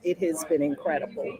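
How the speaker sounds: ordinary talk, nothing marked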